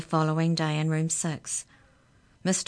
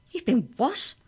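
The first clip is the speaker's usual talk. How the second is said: higher, startled question; 8 semitones higher, 4 dB louder than usual. Acted. Jake startled by Amy's phone call, voiced higher. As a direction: Jake can't believe his ears.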